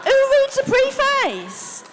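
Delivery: high, drawn-out disbelief; incredulous. Real, not acted. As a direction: squeal the question slowly in disbelief. Incredulous question to the audience.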